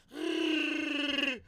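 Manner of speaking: high, loud Wookiee yowl; Wookiee howl. Shown as a demonstration. Showing how he sounds running up stairs, loud Wookiee yowl. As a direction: let out a yowling Wookiee cry. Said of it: "How come I sound like a Wookiee?".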